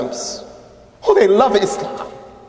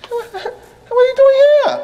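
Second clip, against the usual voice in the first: astonished stranger; slower than usual. Retold or imitated. Strangers in Mecca questioning boy.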